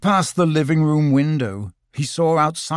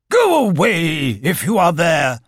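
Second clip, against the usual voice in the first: slow raised-voice order; shouting; 3 semitones higher, 4 dB louder than usual. A narrator's reading. Owl shouting at someone unseen from a tree, shouting. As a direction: call out into the dark. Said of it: "shouted Owl".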